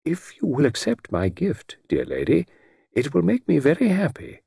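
lightly voiced gentleman, lilting up high; gallant Mister Satterthwaite. A narrator's reading. Satterthwaite offers Lady Charnley his gift on the phone, gallantly. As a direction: offer it graciously, with a high lilt.

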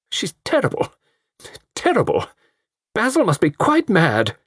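high worried matron's lament; high fretful mother. A narrator's reading. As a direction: wail it like a fussing mother.

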